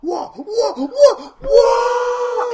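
high, loud wailing cries; orc war cry. Acted. Barsher howls along with the orcs. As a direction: howl like a frenzied orc.

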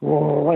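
drawn-out mock warrior growl; imitated growl. Little girl stalking like a warrior, mimicked growl. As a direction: growl like a toddler playing warrior. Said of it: "making that sound".

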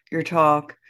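usual speaking voice

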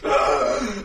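sharp startled gasp; fright gasp. Retold or imitated. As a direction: gasp as if seized by fear.

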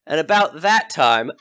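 ordinary delivery

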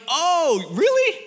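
high, delighted imitated reaction; almost happy surprise. Friend's mom hearing he never knew, delighted surprise. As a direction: squeal it with gleeful surprise. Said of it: "She's almost, like, happy about it".

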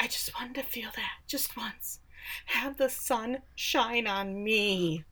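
hushed mock-yearning, slowing at the end; melodramatic whisper. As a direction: imitate a soapy, wistful confession under the breath. Melodramatic acted line of Jeanette.

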